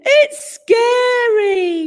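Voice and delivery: high, slow whiny cub wail; whining. A narrator's reading. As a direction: whine it like a scared little bear. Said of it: "whined the one with the biggest".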